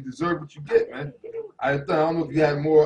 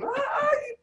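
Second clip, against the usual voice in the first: high, crying mock wail of a panicked mother; hammed-up screeching mother; hysterical woman; 7 dB louder, faster than usual. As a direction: shriek it like a frantic mother. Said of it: "She make a big deal".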